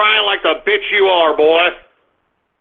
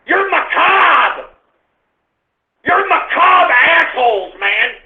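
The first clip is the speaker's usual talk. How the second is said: angry yelling; 5 semitones higher than usual. Real, not acted. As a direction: berate the chat at full volume. Yelling insults at chat.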